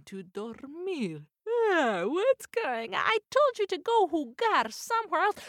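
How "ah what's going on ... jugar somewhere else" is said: higher, louder groggy scolding mother mouse; annoyed mama mouse. Acted. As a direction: grumble like a woken mother.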